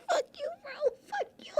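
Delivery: high, breathless laughing words; laughing squeak. Real, not acted. Streamer retelling a friend's tease, squeaking with laughter. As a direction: squeak it through giggles.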